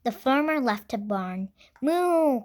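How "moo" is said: cow moo. Acted. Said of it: "cried Cow".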